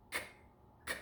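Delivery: "K" alone as a short, crisp click; isolated first sound. Shown as a demonstration. Teacher isolating the first sound for a pupil, "K" by itself. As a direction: say just the consonant "K", no word. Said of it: "What was that first sound?".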